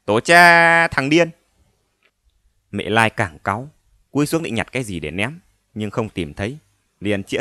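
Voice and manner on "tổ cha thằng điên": loud, slow mocking curse; mocking curse back. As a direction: throw the same curse back slowly. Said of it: "chửi trả".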